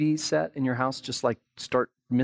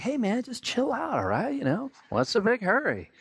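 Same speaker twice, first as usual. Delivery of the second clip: mock chill; 8 semitones higher than usual. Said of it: "You're like".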